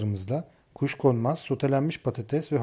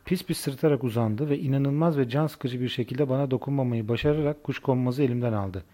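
plain reading voice

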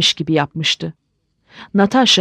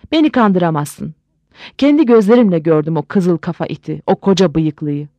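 usual speaking voice

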